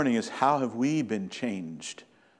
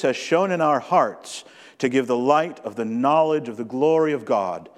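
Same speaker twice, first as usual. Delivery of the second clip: solemn, measured Bible reading, building louder; scripture reading; 4 dB louder than usual. Acted. Pastor reading John and Corinthians to the church, solemn reading. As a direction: read the verses as sacred text.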